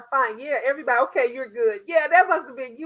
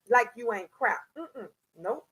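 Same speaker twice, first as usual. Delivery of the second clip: ordinary delivery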